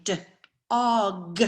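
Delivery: "d" then a pause, then "og"; slow sound pushing. Shown as a demonstration. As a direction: split the word into onset and rest. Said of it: "saying those words very slowly".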